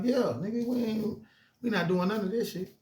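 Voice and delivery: high, emphatic no; firm refusal. Retold or imitated. Telling his partners they are going back in, high and firm. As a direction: shut the plan down firmly.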